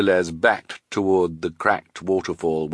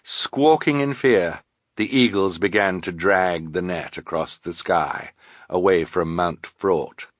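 ordinary delivery, nothing marked

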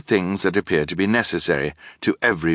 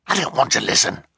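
angry whisper; 6 semitones higher, faster than usual. Acted. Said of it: "in an angry whisper".

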